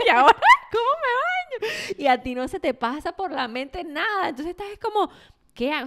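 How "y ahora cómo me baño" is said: high, loud laughing wail; mock-helpless cry, bursting with laughter; agobio en broma. Acting out a panicked new mother. (agobio fingido, agudo y entre risas)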